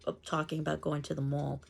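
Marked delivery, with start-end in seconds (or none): 1.2-1.6 mall with a New York "aw" vowel; New York-accented mall, rounded aw. Shown as a demonstration. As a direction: let New York come out, "mawl". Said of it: "with the aw sound".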